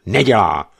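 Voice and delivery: furious officer ranting about Farabi; angry rant. A narrator's reading. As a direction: spit out the grievance. Bennett rants back.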